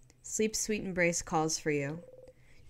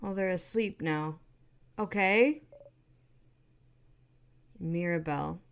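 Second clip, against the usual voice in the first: normal talking voice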